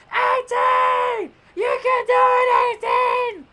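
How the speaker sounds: very high screamed cheer; Krillin. Retold or imitated. Husband cheering eighteen from the stands, imitated high scream. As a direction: imitate a frantic supporter screaming her name. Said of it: "you hear him screaming out her name".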